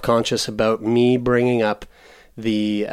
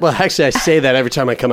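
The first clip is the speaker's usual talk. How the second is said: normal talking voice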